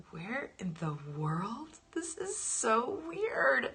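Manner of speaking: slow, drawn-out disbelief rising high; acted amazement. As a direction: stretch each word in baffled wonder. Retelling her amazement at the temple.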